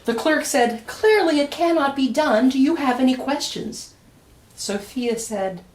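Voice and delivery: lively, sing-song picture-book reading; reading to children. A narrator's reading. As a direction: read it aloud to a room of kids.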